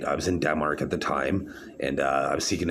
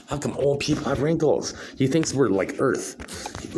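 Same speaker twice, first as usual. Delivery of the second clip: mocking; 8 semitones higher than usual. Mocking the doctor's claim.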